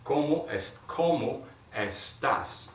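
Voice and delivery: "cómo estás" very slow, the s stretched; slow model. Shown as a demonstration. Teacher repeats "¿Cómo estás?" slowly for learners. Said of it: "See the verb estar".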